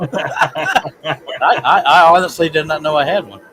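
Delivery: loud, high, laughing while speaking. Real, not acted. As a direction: say it as you crack up. Laughing about his accent.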